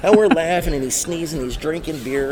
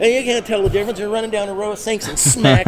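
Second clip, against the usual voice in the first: much higher, louder storytelling rush; excited; 11 semitones higher, 5 dB louder than usual. Real, not acted. Trainer tells the podcast about the hidden explosive, high and excited. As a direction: build to the surprise.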